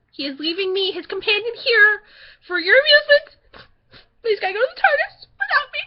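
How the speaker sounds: high, loud melodramatic whine; hammed-up wail, rising as it goes; mock heartbroken lament. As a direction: complain like an abandoned companion. Play-acted abandoned companion lament.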